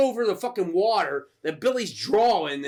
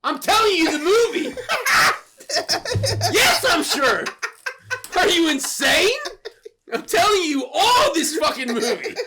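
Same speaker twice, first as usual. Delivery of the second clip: loud, high, laughing outrage; indignant insistence; 9 semitones higher, 5 dB louder than usual. Real, not acted. Answering a friend's "are you sure", laughing indignant shout. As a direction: insist at the top of the voice, cracking up. Challenged on his account, comic indignation.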